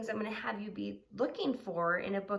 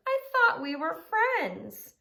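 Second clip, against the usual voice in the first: hurt little dog; 9 semitones higher, 9 dB louder than usual. Acted. Trevor hurt.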